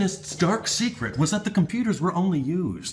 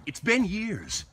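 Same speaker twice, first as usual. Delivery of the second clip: ordinary talk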